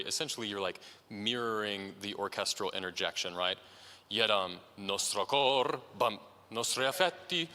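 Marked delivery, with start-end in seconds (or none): 4.1-7.5 unhurried declamation. Shown as a demonstration. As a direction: take time on each word like a declamation. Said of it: "take a little bit more time on those".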